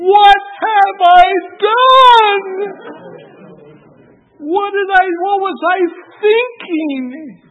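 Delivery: high, loud, crying panic; horrified grandfather. Retold or imitated. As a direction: cry out in horror at your own blunder. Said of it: "And I'm thinking".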